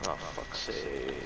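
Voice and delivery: defeated mutter. Real, not acted. Defeated groan.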